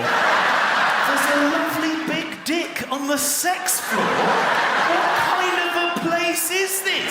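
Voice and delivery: high, drawn-out, hammed-up shock; mock scandalised disbelief. Guest repeating the receptionist's words back, mock scandalised. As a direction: repeat it slowly in soaring comic outrage.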